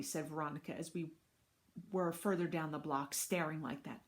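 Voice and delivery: ordinary delivery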